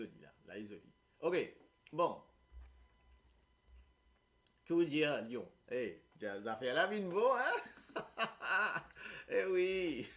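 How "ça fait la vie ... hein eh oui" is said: laughing. Real, not acted. Laughing with the class.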